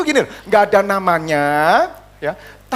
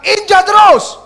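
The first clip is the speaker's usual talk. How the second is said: high, loud rallying cry; full-voiced preacher's challenge; defiant shout; 9 semitones higher, 7 dB louder, slower than usual. Shown as a demonstration. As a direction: shout it for the congregation to follow. Tells congregation to say it, shouting.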